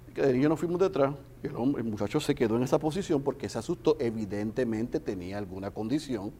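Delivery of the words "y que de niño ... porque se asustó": ordinary delivery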